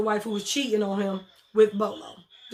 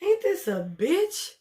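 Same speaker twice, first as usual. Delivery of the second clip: higher, slow, exasperated disbelief; mock disbelief; 7 semitones higher, slower than usual. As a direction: drag it out like a stunned stripper. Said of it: "that's what Babyface looked like".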